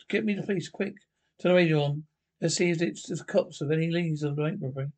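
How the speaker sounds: normal talking voice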